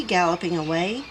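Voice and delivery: ordinary delivery, nothing marked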